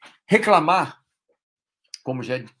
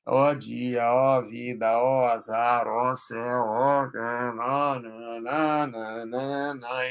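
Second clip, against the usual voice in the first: mock lament mantra; 3 semitones lower, much slower than usual. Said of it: "vira um mantra".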